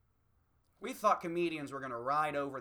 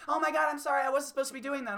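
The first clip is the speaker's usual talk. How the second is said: flustered apology; 10 semitones higher, 6 dB louder, faster than usual. Retold or imitated. Caught in the bathroom, apologizing.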